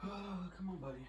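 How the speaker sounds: high coaxing pet voice; talking to a pet. Real, not acted. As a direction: sweetly coax the cat. Coaxing his cat out.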